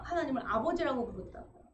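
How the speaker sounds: ordinary talk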